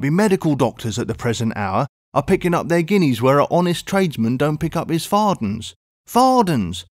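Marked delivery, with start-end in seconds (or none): 6.1-6.8 indignant exclamation. A narrator's reading. Indignant repetition of fardens.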